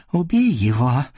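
a hint of a hissing witch. A narrator's reading. Witch hisses at the voivode, a light hiss. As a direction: hiss the order like a scheming witch. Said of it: "на воеводу шипит".

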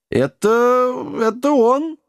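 high-pitched tipsy gnome voice; slurring gnome. A narrator's reading. Drunk gnome falling into heather, slurring. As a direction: stammer it out high, tongue tangled. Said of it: "заплетающимся языком проговорил гном".

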